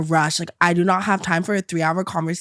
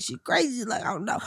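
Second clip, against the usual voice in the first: hushed aside; 3 semitones higher, 9 dB quieter than usual. Retold or imitated. Aside thought.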